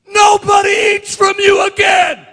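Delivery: high, loud, slow furious pronouncement; angry curse. Acted. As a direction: curse the tree in anger. Jesus curses fig tree.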